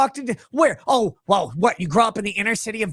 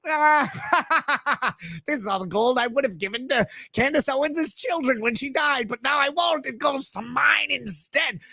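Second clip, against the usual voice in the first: gloating villain laugh; 3 semitones higher than usual. Retold or imitated. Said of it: "He's like".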